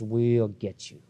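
low, slow, quiet retold threat from a thug; low, slow mock threat; menacing threat. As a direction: imitate the defendant's low menace. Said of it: "That's what he said".

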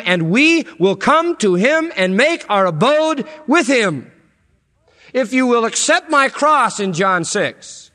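loud, high, impassioned preaching; soaring revival preacher cry; preaching at full force. Acted. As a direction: build it up like a pulpit climax.

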